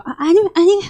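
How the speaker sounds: ordinary talk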